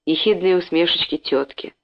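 plain reading voice

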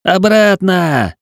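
high, slow yell; shouting. A narrator's reading. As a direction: yell it right into his ear. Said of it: "закричал он мне в ухо".

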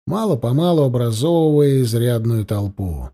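plain reading voice

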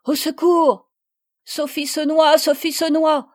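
loud high cries with a hint of a sob; a touch of a tearful scream for help; sobbing cries. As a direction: wail it out in despair. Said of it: "sanglotait Marguerite désolée".

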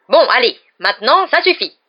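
loud, rising impatience; exasperated command. A narrator's reading. Prefect Percy orders everyone to stop, exasperated. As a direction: put your foot down loudly.

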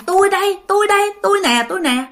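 high, imitated tearful insistence; lightly acted weeping cry; mock crying plea. Eldest uncle insists to the family, imitated crying plea. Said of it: "mà ông cả cứ khóc".

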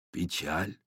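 put-on low, soft old wizard voice; deep Father Frost voice. A narrator's reading. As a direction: murmur it like an ancient wizard. Дед Мороз's voice. (низкий тихий голос Деда Мороза)

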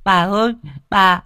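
put-on high, loud, terrified child's wail; a small child crying out to grandma in fear. A narrator's reading. Child wailing to grandma, high and loud. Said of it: "nó khóc ầm ĩ".

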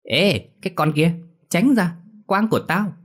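very high shrieking camp voice; whiny camp voice. Acted. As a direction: screech it, jealous and camp. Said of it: "giọng eo eo cất lên".

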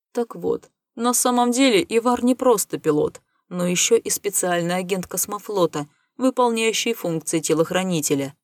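plain reading voice

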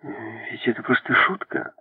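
plain reading voice

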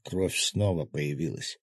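ordinary delivery, nothing marked

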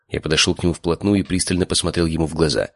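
usual speaking voice

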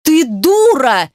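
drawn-out, high angry insult; exasperated bellow; roaring. A narrator's reading. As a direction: roar it slowly, stretching the vowel. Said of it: "взревел он".

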